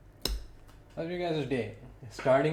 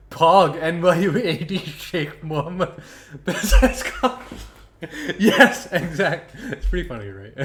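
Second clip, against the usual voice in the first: high, loud, laughing through the words; real laughter; 4 semitones higher, 8 dB louder than usual. Real, not acted. Caster reads viewers a funny message, cracking up laughing. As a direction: crack up while reading it out.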